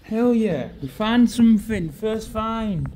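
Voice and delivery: high, loud burst of real excitement; excited whoop. Real, not acted. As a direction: cheer the first find out loud. First catch while magnet fishing.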